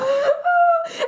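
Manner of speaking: high laughing till crying; real laughter. Real, not acted. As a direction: laugh until tears. Laughing at mock whine.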